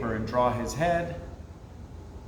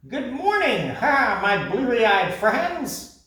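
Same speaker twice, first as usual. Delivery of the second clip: high, loud, laughing voice, slow on the end; laughing parrot; 8 semitones higher, 4 dB louder, slower than usual. Acted. Parrot laughing at his sleepy friends, high and merry. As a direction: tease them through a laugh. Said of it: "Papagayo laughed".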